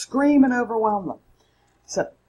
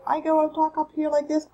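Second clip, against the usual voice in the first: raised pitch; 11 semitones higher than usual. Shown as a demonstration. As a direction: talk up high, well above the usual voice. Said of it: "I've raised my pitch".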